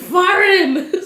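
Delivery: very high Patois exclamation, laughing; exaggerated high Jamaican Patois. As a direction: imitate an emphatic Patois reply through laughter. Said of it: "translating it into the Patois dialect".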